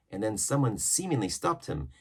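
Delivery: usual speaking voice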